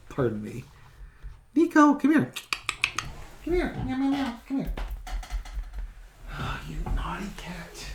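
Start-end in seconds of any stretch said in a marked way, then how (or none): 1.5-2.3 much higher sing-song call of Nico; squeaky sing-song call to a cat. Real, not acted. Calling the cat again, higher. 3.4-4.7 much higher, soft coaxing with meows; high pet voice. Real, not acted. 6.4-7.8 whispered fond scolding; softly whispered mock scolding; whisper to cat. Real, not acted.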